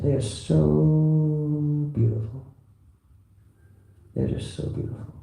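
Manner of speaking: awed hush. Retold or imitated. Awed quote of a devotee.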